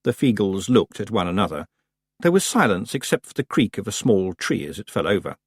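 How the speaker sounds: ordinary delivery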